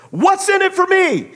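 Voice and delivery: high, loud bitter demand; yelling. Retold or imitated. Ray answering Shoeless Joe, loud bitter demand. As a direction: hurl the question like an accusation. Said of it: "he's yelling about it".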